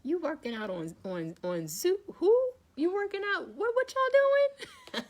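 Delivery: high, loud, baffled voices of people who doubted her; mock the doubters in loud disbelief; mocking skeptics. Imitating people who laughed at her Zoom workouts, loud baffled mock. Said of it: "They're like".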